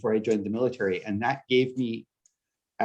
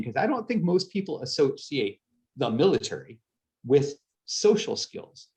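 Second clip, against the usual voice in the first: ordinary talk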